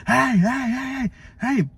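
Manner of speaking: woman shouting at goose with broom; lady yelling at goose. Retold or imitated. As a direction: shoo the goose off, flustered.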